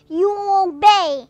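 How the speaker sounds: loud, slow godlike command; commanding giant. Retold or imitated. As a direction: boom it down at the crew. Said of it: "And then the man says".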